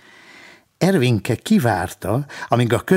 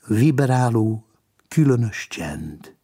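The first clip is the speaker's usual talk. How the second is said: plain reading voice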